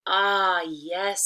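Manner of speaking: loud eager tree voice. Acted. Disguised wolf agreeing too eagerly, loud. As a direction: jump at it eagerly. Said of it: "said the tree".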